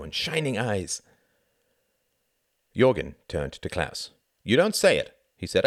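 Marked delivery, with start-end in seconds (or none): 4.4-5.2 high, emphatic accusation; accusing. A narrator's reading. Pilot turning on the newcomer, accusing. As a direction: confront him in a raised voice. Pilot confronting Klaus about the toast.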